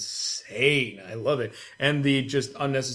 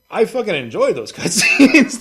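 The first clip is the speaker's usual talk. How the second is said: higher, louder, laughing through it; 8 semitones higher, 9 dB louder than usual. Real, not acted. Telling a friend about loved cutscenes, laughing. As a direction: gush with a laugh.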